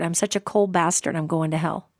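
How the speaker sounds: low, soft, hopeless self-condemnation; quiet despair. Retold or imitated. Dying man condemning himself to the psychic, quiet and hopeless. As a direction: mutter it like a broken old man. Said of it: "he kept calling himself a cold bastard".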